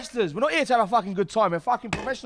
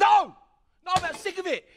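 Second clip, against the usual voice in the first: angry outburst refusing to relax; angry shout; 7 semitones higher than usual. Acted. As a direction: snap back at him hard. Angry outburst at tag partner.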